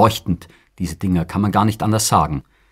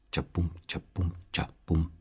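hushed drum sounds; 10 dB quieter than usual. Acted. Soft drum-beat sound poem.

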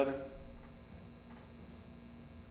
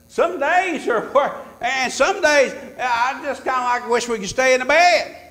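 higher, rising preacher build; preaching; 10 semitones higher, 10 dB louder than usual. Acted. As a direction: lean into the congregation.

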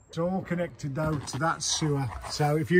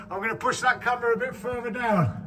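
cave echo; 6 semitones higher than usual. Real, not acted. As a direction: voice bouncing off a hollow chamber. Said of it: "You sound like you're in a cave".

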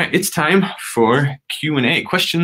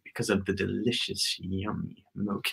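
dreamy hushed voice; 3 semitones lower, 12 dB quieter than usual. Acted. Joke answer, dreamy milk.

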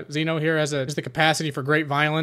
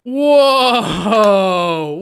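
loud, drawn-out whoa; startled yell; 3 semitones higher, 7 dB louder than usual. Real, not acted. Player reacting to the firefight, yelling. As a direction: cry out in shock.

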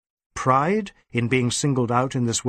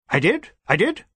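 surprised; 7 semitones higher, 3 dB louder than usual. Acted. DSP surprised.

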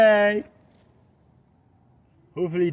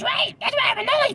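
squeaky voice. Acted. High put-on voice.

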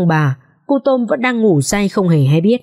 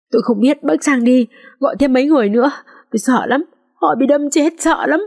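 frightened, breathless telling; 7 semitones higher than usual. A narrator's reading. Maid telling neighbour of murders.

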